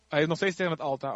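ordinary talk, nothing marked

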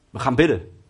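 put-on the girlfriend settles it: we pray now; firm girlfriend. Girlfriend calming her angry boyfriend down, firm and decided. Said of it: "En Jessica zegt tegen mij".

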